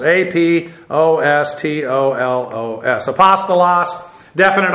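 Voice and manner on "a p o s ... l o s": A P O S T O L O S spelt slowly letter by letter; slow Greek spelling A P O S T O L O S. Shown as a demonstration. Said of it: "we have are apostles".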